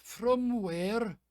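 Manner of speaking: high, puzzled Scottish voice; Scottish man asking, baffled; Scottish brogue, questioning; Scottish accent. Acted. GM plays Callum.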